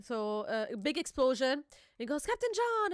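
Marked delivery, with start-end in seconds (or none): 2.2-2.9 high exclamation, lightly acted; mock alarmed cry. Retelling a character's cry after the explosion, mock alarm. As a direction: call the name out high, half-shouted.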